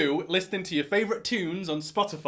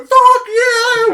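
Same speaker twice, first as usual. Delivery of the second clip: screaming at the top of the lungs, much higher and louder; furious scream; 14 dB louder than usual. Retold or imitated. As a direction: scream it in blind rage at the door. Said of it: "started banging on it and going".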